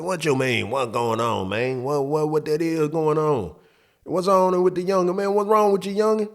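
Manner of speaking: rising, lightly voiced old friend; a hint of the concerned older buddy; friend's voice. Stepfather's friend asking the boy what's wrong, lightly voiced.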